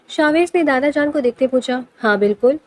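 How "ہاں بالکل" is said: flat, firm and final, pitched lower; a low unshakable yes. A narrator's reading. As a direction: settle it with no room to argue. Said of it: "اٹل لہجے میں بولے".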